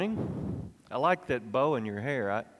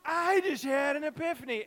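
excited; 13 semitones higher than usual. Shown as a demonstration. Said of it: "Do this with me".

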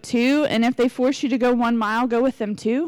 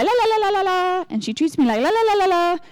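higher-pitched singsong blah-blah; mock griping babble; 6 semitones higher than usual. Complaining to friends about what she did, mock griping babble. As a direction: rattle off nonsense syllables as a stand-in grievance.

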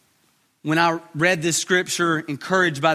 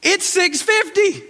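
put-on dad to kid; 9 semitones higher than usual. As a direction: hurry the kid out the door. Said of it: "I'm like".

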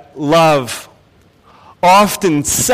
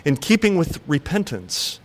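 measured, solemn Bible reading; scripture reading; 3 semitones lower than usual. Acted. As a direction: read the Gospel aloud with weight.

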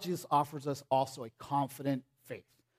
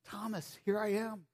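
tender Jesus; 4 semitones higher than usual. Retold or imitated. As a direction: gently coax a doubting friend.

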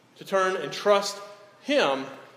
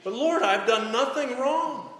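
high, louder protest to God; pleading Job; 5 semitones higher, 5 dB louder than usual. Acted. Job protesting his innocence to God, high and pleading. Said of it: "He's questioning".

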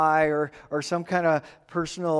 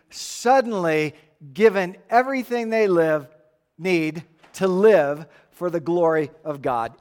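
normal talking voice

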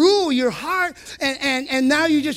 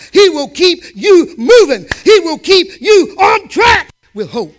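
preaching at full force; 4 semitones higher, 8 dB louder than usual. Acted. Preacher rallying the church, full-force shout. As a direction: build the crowd up to a roar.

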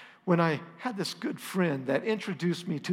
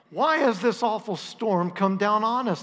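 demanding; 4 semitones higher, 6 dB louder than usual. A narrator's reading. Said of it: "they demanded".

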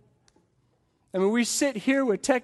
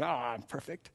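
soft, low mock smugness; self-satisfied man; 6 semitones lower, 11 dB quieter than usual. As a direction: toss it off quietly, mocking his perfection. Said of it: "imagine Jesus hearing this guy saying".

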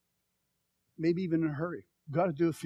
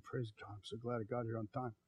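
a touch of soft relieved murmur, much softer; relieved inner thought; 6 semitones lower, 12 dB quieter than usual. Retold or imitated. Preacher retelling his thoughts at a stoplight, murmuring softly. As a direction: mutter it to yourself with relief.

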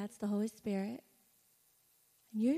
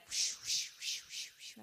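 whooshing sound; 5 dB quieter, slower than usual. Retold or imitated. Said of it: "my life was like".

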